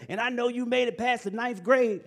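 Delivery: put-on high raspy old man. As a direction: rasp it like an old mentor. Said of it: "in his raspy voice".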